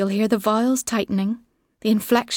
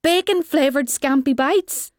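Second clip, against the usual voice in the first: higher, rising disbelieving question; mock incredulity; 5 semitones higher than usual. A narrator's reading. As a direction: exclaim it back as if astonished. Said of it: "will shout in mock incredulity".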